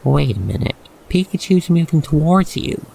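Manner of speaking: ordinary talk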